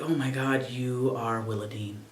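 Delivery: moved realization, almost murmured; hushed awe. Retold or imitated. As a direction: breathe it out in quiet wonder. Awestruck at the film.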